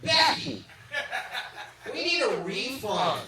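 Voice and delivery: high mock woman's voice calling out; disgruntled bachelorette. Bachelorette complains to her friend Becky about the entertainment, high mock complaint. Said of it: "she looks me up and down and she goes".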